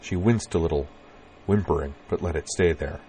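ordinary delivery, nothing marked

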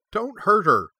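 put-on girl begging guards to spare her pup; pleading. A narrator's reading. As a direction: beg them to stop. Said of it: "Cora pleaded".

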